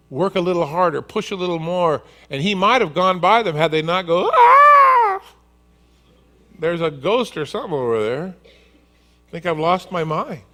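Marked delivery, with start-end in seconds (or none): 4.3-5.2 very high hammed-up shriek; mock scream, high and loud; terrified scream. Disciples see a ghost. 6.6-10.4 a touch of fright, slightly louder; frightened disciple doubting his eyes; lightly voiced scared fisherman; mild mock panic.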